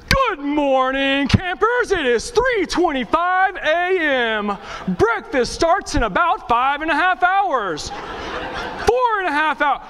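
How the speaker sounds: high, stretched-out wake-up announcement; mock camp announcer. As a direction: parody a camp loudspeaker voice, improvising as you go. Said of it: "they get on this silver voice".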